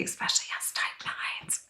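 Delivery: whispered aside. Acted. A lazy nine confessing under her breath in a whisper. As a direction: whisper it like a guilty secret.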